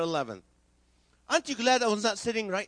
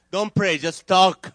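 loud slow punchline; 8 dB louder, slower than usual. Acted. Punchline telling tall man.

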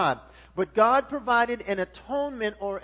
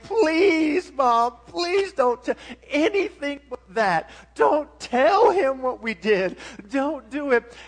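loud, high pleading to mom; begging child; 4 semitones higher, 7 dB louder than usual. Retold or imitated. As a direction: grovel and beg like a scared kid. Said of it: "grovel and beg".

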